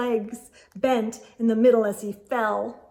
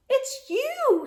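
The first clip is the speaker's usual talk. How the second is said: relieved surprise. A narrator's reading. Porcupine relieved.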